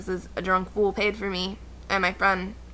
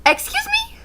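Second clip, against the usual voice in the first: high, shocked Excuse me, disbelief in every word; outraged disbelief. Retold or imitated. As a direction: squeal it out in sheer disbelief. Outraged at the question.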